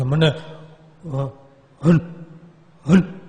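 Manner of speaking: halting, stuttered oath; struggling stammer. Retold or imitated. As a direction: choke on the name. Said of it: "Hanumanji નામ ન બોલી શકે".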